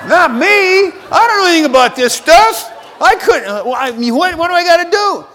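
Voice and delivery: mock panic. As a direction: play the panicked husband. Panicked refusal to wife.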